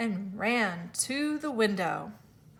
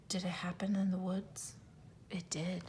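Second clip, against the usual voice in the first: whisper; 3 semitones lower, 13 dB quieter than usual. Shown as a demonstration. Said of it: "mock gasp to students".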